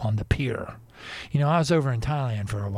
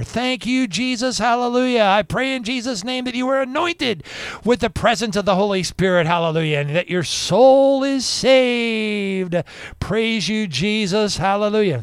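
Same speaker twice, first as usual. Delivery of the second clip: fervent praise prayer; 8 semitones higher, 7 dB louder than usual. Acted. Host to viewers, loudly praying to repel the evil ones. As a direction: call out praise to drive evil away.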